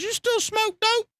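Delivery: high, slow, squeaky put-on voice for the buddy; mocking Larry's question. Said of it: "he goes".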